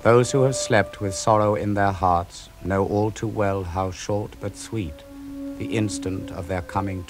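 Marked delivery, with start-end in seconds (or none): none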